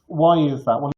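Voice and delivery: usual speaking voice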